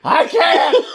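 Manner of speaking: high yell, laughing; mock yell. Yelling driver telling the cop he can't stop, laughing. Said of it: "Sir, stop yelling".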